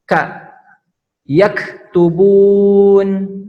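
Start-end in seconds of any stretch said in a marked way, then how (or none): 1.3-3.4 "Yaktubun" slow and loud with a hard pressed k; pressed kaf. Shown as a demonstration. Teacher showing the pressed kaf in "Yaktubun", slow and loud. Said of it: "harus ditekan huruf kaf ini".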